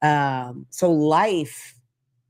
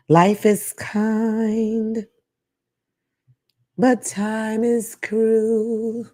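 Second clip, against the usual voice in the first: each word drawn out very slowly, higher; slow dramatic delivery; 6 semitones higher, much slower than usual. Shown as a demonstration. Host repeating the saying to listeners, slow and dramatic. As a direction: savor the saying slowly and deliberately. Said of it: "like I said".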